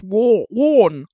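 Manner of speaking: "War, warn" shouted, "warn" with the vowel of "war"; loudly drawn "warn" like "war"; funny accent. Retold or imitated. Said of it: "He goes".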